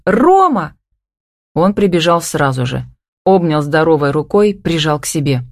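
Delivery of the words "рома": high, urgent cry of his name; shouted call. A narrator's reading. Lost woman calling her partner, shouting. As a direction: call out for him in panic. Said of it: "закричала, что было мочи".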